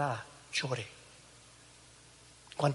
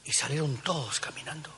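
softly whispered narration; 5 dB quieter than usual. Real, not acted. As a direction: hush it down. Hushed narration after the shout.

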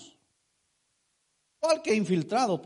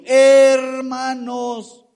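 Hermanos sung out long, much louder and higher; loud drawn-out chanted Hermanos; emphasized word; 5 semitones higher, 11 dB louder, much slower than usual. Acted. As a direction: stretch Hermanos into a loud slow call to the congregation. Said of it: "Yo quiero enfatizar en es-, perdón, en esa palabra".

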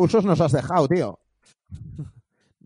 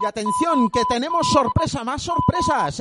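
higher, excited host announcing; excited announcement; 9 semitones higher than usual. Real, not acted. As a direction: build it up like a big reveal. Announcing a surprise guest.

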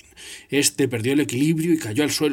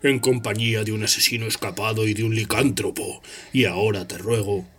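icy, contemptuous teacher; Snape's voice; 7 semitones lower than usual. Acted. Snape scolds Hermione.

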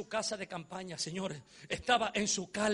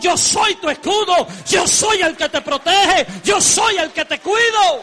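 much higher and louder, slow shouted declaration; God's shouted promise; 11 semitones higher, 19 dB louder, slower than usual. Acted. Preacher voicing God to the church, slow and shouting. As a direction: thunder it out as God. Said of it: "Dios dijo".

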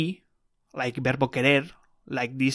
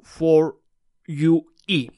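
for U E, each word punched louder; stress on U E; 3 semitones higher, 6 dB louder, slower than usual. Shown as a demonstration. Teacher stressing the vowel change, louder. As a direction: hammer home U E. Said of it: "stressing the vowel change".

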